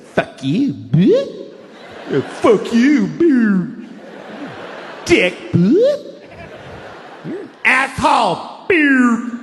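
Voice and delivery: hammed-up text-fight screaming match; mock insults. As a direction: trade petty insults, building to a yell. Acted text-message insult fight.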